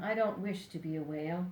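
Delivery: plain reading voice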